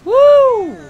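high, loud "woo"; one long piercing whoop; excited whoop. Real, not acted. Player whooping at the game, excited whoop.

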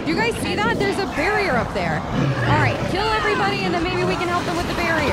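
loud, high urgent rallying; rally the party with a shout; excited call. Real, not acted. Player spotting survivors, excited loud rallying call. Excited calls during battle.

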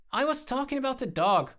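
high, loud protest; indignant retort. A narrator's reading. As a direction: correct him in exasperation. Indignant retort to his teasing.

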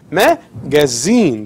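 ماجازين with the final زين drawn out and stressed; stress on last syllable. Shown as a demonstration. Teacher showing students the strong syllable, stress on the end of ماجازين. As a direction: lean slowly on زين in ماجازين. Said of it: "ال strong syllables".

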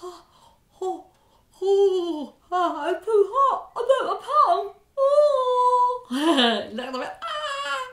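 high, loud hammed-up gasping and laughing at the heat; comic hot-mouth panting with laughter; too hot face. Shown as a demonstration. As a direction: act out burning your mouth for the kids.

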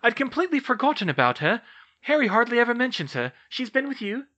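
high, fast, surprised chatter; fast, high-voiced realisation; eager young witch. A narrator's reading. Narrator voices Hermione.

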